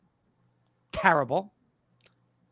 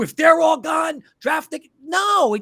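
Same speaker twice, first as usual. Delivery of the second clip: higher, louder heated yell; heated outburst; 7 semitones higher, 6 dB louder than usual. Real, not acted. Host ranting about draft logic, heated outburst. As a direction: blow up at the bad idea.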